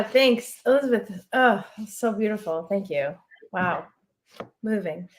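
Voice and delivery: normal talking voice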